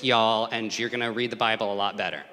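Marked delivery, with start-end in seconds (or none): 0.0-0.5 "y'all" punched out loud; stressed y'all. Shown as a demonstration. Preacher tells the congregation to read it as y'all, punched. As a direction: hit the word "y'all" hard. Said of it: "replace it with".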